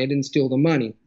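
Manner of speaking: "money" stressed, falling at the end. Shown as a demonstration. Literature example for the host, stressing "money". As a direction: land hard on the word "money". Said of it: "but the stress is different".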